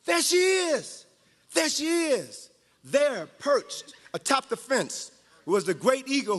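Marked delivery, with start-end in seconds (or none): 0.0-2.5 higher, slow excited cry of a chicken; mock shout from the yard. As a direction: point and cry out. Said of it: "and shouted".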